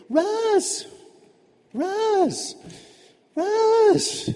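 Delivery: high-pitched drawn-out name call. Acted. Girl calling after a classmate who ignores her, calling out.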